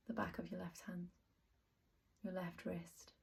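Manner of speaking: soft, unhurried meditation voice with long pauses; guided meditation. Acted. Teacher talking listeners through a yoga nidra body scan, soft guided meditation. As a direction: guide them gently into rest, softly, phrase by phrase.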